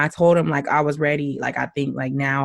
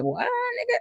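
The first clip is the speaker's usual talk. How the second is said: mock surprised "what"; 7 dB quieter than usual. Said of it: "he was like".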